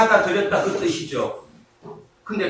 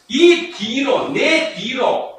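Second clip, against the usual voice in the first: slow emphasis; 2 semitones higher, much slower than usual. Shown as a demonstration. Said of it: "이 뒤로가 헬라로 오피소무입니다".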